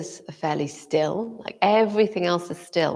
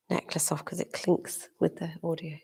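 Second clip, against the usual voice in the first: quick whispered aside; 10 dB quieter than usual. Real, not acted. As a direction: whisper it off-mic. Aside while removing necklace.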